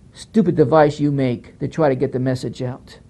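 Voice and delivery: ordinary talk, nothing marked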